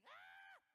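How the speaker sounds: high shrieking fan squeal; a sudden high-pitched fangirl shriek; fan scream. Retold or imitated. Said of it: "She ran over to Martin screaming".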